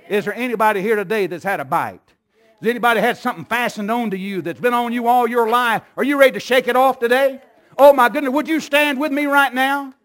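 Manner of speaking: loud, high, urgent preaching; full-force altar call. Acted. Pastor calling the congregation to stand, loud preaching.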